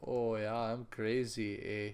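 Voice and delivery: slow, put-on Canadian accent; drawn-out mock Canadian accent. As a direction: lay on a hokey Canadian accent, slowly. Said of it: "stereotypical Canadian accent".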